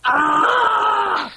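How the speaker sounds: sudden high pained scream. Acted. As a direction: yelp like something just bit you. Pencil sharpener punchline scream.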